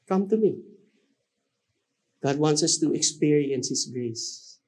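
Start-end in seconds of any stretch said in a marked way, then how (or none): 0.1-0.6 higher, brighter repeat of the invitation; raised pleading call of God; lifted call. Acted. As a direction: reach out with it. God's call voiced by preacher.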